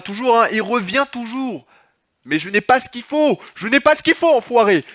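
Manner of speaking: high, loud frantic shouts; panicked yelling. Real, not acted. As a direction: yell in pure panic as he closes in. Ennemi revient, il s'affole. (cris affolés, aigus et forts)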